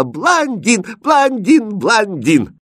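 very high, loud squeals of glee; excited shouting. Acted. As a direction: cry out like a thrilled princess. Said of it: "захлопала в ладоши и закричала". (восторженно кричит)

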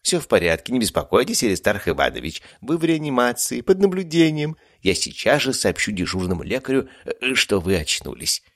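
calm a frightened patient; reassuring nurse. A narrator's reading. (успокаивающе, высоко)

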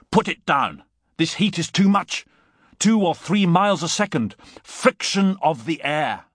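loud, high-pitched protest; urgent cry. A narrator's reading. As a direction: shout warnings to a reckless friend. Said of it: "I cried, coming up".

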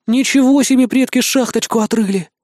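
high, excited exclamation; amazed. A narrator's reading. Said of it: "Аж присвистнул Никитка".

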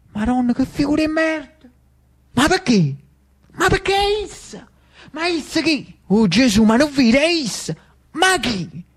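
high, flustered crowd exclamations; flustered disbelief. Acted. The crowd realizing who has arrived, high-pitched dismay. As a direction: exclaim in acted shock, pitch jumping up.

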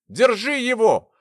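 loud, high, drawn-out order; insistent command. A narrator's reading. Persistent pursuer to the friend holding her quarry, insistent shout. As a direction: call out insistently over the protest. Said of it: "воспротивилась настырная преследовательница".

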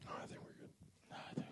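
hushed whisper. Real, not acted. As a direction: whisper so no one hears. Sneaking, fear of being heard.

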